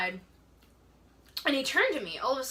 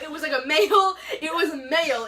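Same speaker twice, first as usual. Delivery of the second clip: laughing; 6 semitones higher, 7 dB louder than usual. Real, not acted. Laughing while insisting on it.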